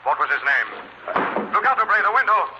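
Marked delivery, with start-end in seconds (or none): 1.5-2.5 higher, sharp warning shout; urgent warning. Acted. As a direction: warn him of the window in a hurry.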